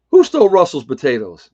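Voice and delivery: loud, high-pitched joking accusation; mock outcry. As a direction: call it out in pretend outrage. Joking mock outcry about slip.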